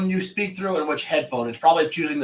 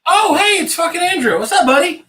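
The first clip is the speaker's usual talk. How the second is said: much higher, loud happy hello; excited greeting; 13 semitones higher, 6 dB louder than usual. Real, not acted. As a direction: greet a buddy with delight. Greeting friend joining the stream.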